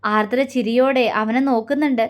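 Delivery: ordinary delivery, nothing marked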